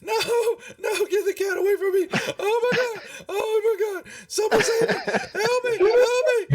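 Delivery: hysterical high falsetto wailing; hammed-up sobbing panic, very high; crying halfling. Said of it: "you know, crying".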